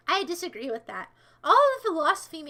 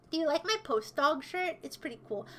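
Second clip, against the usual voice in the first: ordinary talk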